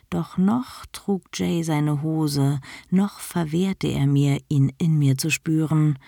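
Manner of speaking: usual speaking voice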